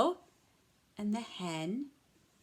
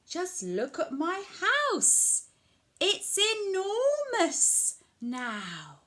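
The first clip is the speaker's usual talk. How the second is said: put-on higher, delighted old lady boasting; lightly voiced proud old lady; 10 semitones higher, 5 dB louder, slower than usual. Old lady boasting to old man, higher delighted. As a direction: show off the house in a higher voice.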